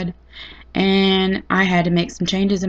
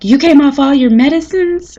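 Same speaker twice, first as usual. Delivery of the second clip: higher, louder mock-outraged mom; angry mom; 7 semitones higher, 7 dB louder than usual. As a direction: imitate a furious mom scolding. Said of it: "she was mad as a hornet".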